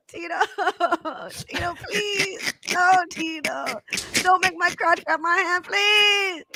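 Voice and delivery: high, drawn-out wailing plea; hammed-up sobbing, begging for help; mock crying. Crying to Tito over a stuck hand, mock crying. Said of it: "if you were crying to Tito".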